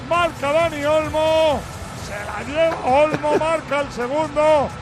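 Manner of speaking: shouted play-by-play over music; breakneck goal commentary. Real, not acted. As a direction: call the goal replay at full force. (narración de gol a grito pelado)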